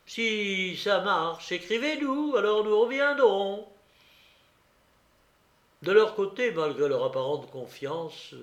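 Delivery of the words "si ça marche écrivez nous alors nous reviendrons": high, sing-song teasing; mocking jibe, pitched well up; mocking little tone. A narrator's reading. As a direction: tease them in a raised, mocking lilt. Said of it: "sur un petit ton moqueur".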